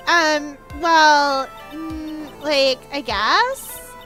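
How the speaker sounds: put-on slow, unsure Valley Girl drawl; Valley Girl accent. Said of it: "Valley Girl accent".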